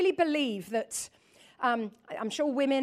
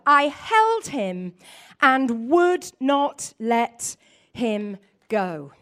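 a preacher reading scripture to the church; 4 dB louder than usual. Acted. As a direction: read the verses with devotion. Reads Song of Songs aloud.

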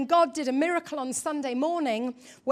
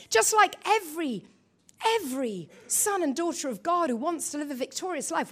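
ordinary delivery

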